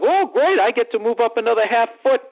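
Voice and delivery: high-pitched mock delight; dumb-ass self. Comic mocking himself as the line shrinks, dopey glee. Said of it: "my dumb ass was like".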